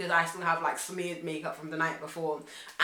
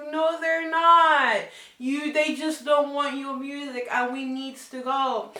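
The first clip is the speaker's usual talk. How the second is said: slow, loud, high exasperated reply; 7 semitones higher, 5 dB louder, slower than usual. Retold or imitated. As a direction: argue back firmly. Arguing with friend.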